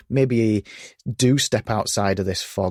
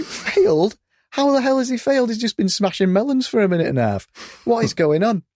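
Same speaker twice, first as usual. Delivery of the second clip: much higher, outraged disbelief; incredulous; 11 semitones higher than usual. Retold or imitated. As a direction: ask it as if it makes no sense at all. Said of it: "And I'm like".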